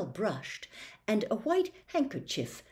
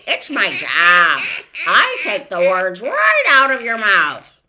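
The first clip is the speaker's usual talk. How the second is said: boastful bird; 3 semitones higher, 11 dB louder than usual. Acted. The word snatcher introduces himself, loud and proud. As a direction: crow about your job.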